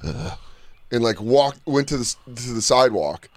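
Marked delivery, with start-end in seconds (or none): 0.0-0.4 low quiet groan; dazed squirrel groan. Retold or imitated. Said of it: "the squirrel's like".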